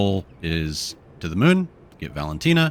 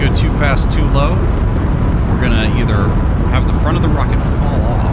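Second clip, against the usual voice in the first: loud raised voice over engine roar; shouting over engine; 2 semitones higher, 7 dB louder than usual. Real, not acted. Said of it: "I know I'm yelling over the, uh, the engine".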